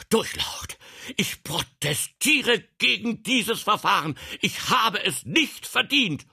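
high, loud, desperate protest; protesting cry. A narrator's reading. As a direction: cry out while struggling against it. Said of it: "Hiller sträubte sich dagegen und rief". (laut und aufgeregt protestierend)

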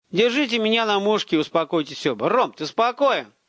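high, firm, carrying instruction; calling out. A narrator's reading. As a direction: project over to both armed boys. Calling to two armed boys.

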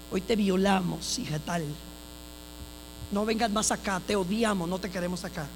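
low, fading mock menace of the callers; threatening callers. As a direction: drop low and quiet like an anonymous threat. Retells threatening phone calls.

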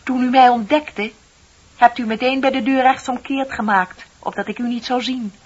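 triumphant exposure. Acted. Exposing her secret.

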